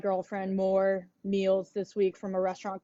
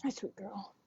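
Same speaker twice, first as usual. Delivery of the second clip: soft pet talk; 11 dB quieter than usual. Real, not acted. Greeting her dog.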